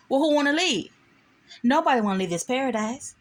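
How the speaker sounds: high, insistent character voices; retold back-and-forth. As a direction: play both sides of the standoff.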